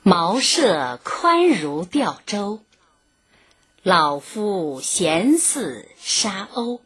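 slow, deliberate classical poem recital; slow emotional poem reading. Shown as a demonstration. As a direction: savor each line of the verse slowly. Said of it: "下面我再给大家朗读一遍这首小令".

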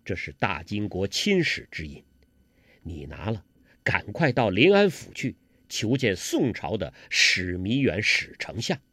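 low, quiet male character voice; hushed, low-pitched worried prince; captive envoy, confiding. A narrator's reading. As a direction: confide gravely in your sweetheart, keeping it down. Envoy gives secret errand.

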